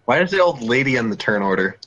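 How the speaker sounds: ordinary delivery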